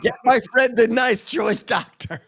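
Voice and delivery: high laughing shout. Retold or imitated. Echoing the quote shouted.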